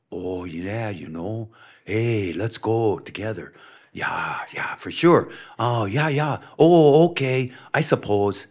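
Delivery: mellow Minnesotan with long round O's; imitated Minnesota small talk; Minnesota accent. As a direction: put on a folksy Minnesota accent, drawn-out "oh" and "yeah". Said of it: "talk like this, but if you're from Minnesota".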